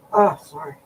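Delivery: usual speaking voice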